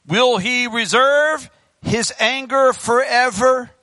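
slow, ringing rhetorical question; drawn-out preacher reading the verse; scripture declamation. Acted. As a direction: declaim it slowly, stretching each phrase.

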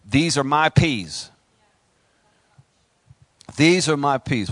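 slow defiant declaration; defiant claim. Pretend. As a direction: plant your feet and claim it. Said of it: "and you say".

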